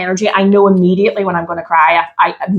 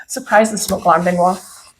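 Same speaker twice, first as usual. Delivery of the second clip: ordinary talk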